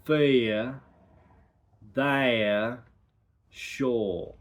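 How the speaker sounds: slow, drawn-out R words; dialect R demo. Shown as a demonstration. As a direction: sound out each R word carefully. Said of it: "The practice sentence is".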